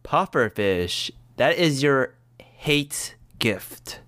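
drawn-out slow pick; slow, deliberate. Acted. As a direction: say it slowly, weighing it.

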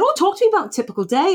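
vet's brisk voice. Retold or imitated. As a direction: mimic the vet's bright, fast chatter. Teller voices the vet.

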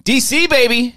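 high, excited cheer; hype shout. Real, not acted. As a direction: call it out like a hype man. Hyping the DC trip.

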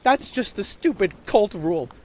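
put-on high, loud child's retort; heated little girl refusing to be left out; indignant young girl. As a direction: argue back stubbornly. Girl argues back angrily.

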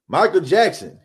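normal talking voice

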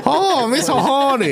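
hammed-up "Mister Honey" come-on. Joking at dinner, playing a prostitute, slow high sing-song.

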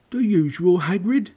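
high, loud character voice; bartender calling out. A narrator's reading. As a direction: pipe up loudly as the barkeep. Bartender greets a regular customer.

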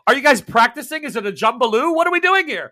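high, loud baffled questions; bewildered. Acted. The game master voices a confused bystander at the strange funeral, high and baffled. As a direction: puzzle over the odd funeral out loud.

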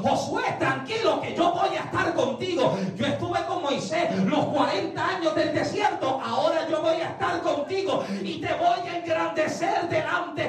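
prédica a pleno pulmón, aguda y encendida; voz de Dios predicada. Acted. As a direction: proclama la promesa divina con fuerza. God's promise preached at full force. (promesa de Dios exclamada)

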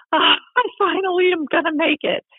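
high-pitched mock weepy voice; imitated tearful relief. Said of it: "I'm not crying 'cause I'm like".

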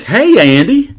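bubbly greeting. Retold or imitated. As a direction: greet him like an old friend. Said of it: "she's like".

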